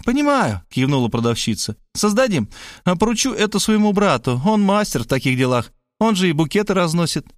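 lightly voiced saleswoman, keen to please; eager saleswoman. A narrator's reading.

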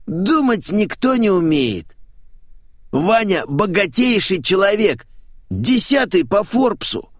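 high, slow, emphatic parrot-man voice; flamboyant showman, scolding. Acted. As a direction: lecture in a high theatrical voice.

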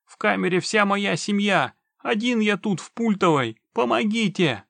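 high, loud, urgent pleading; panicked yelling. A narrator's reading. Man explains his family is in the press, panicked yelling. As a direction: call out desperately, pitch staying high. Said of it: "продолжал орать незнакомец".

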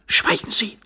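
high, hissed order to be silent; sharp, high-pitched hushed command; curt command. Acted. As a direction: shut him up in a high voice. Stranger orders him silent.

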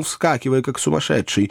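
usual speaking voice

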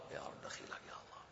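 hushed, whispered supplication; whispered plea. Real, not acted. Teacher pleading with God after the hadith, whispered. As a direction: whisper a prayer under the breath.

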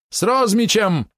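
high, loud and slow; shouted choice. Acted. Polot names Rozmich as opponent, shouting high. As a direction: fling the name out defiantly.

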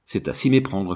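usual speaking voice

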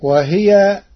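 usual speaking voice

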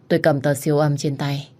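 ordinary delivery, nothing marked

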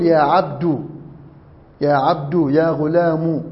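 عبد ending in "u", "ya abdu"; damma ending. Shown as a demonstration. Teacher demonstrating damma, final u on عبد. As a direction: close عبد and غلام on a u sound. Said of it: "حذف الياء وضم الدال".

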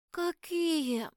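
put-on high, small child's voice; sleepy curious boy. A narrator's reading. Little prince waking, asks heroine, sleepy curious child voice. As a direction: ask like a just-woken little boy. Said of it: "поинтересовался Альфи, распахивая глаза и сладко зевая".

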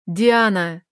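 громко и резко; выпалить имя; выпалил. Acted. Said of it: "выпалил он". (Роман выпаливает имя Дианы, резко)

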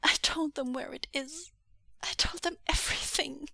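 high, halting, tearful voice; distressed, broken phrases; sobbing confession. Acted. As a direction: stammer out a guilty confession. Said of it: "Xuanyu sobbed".

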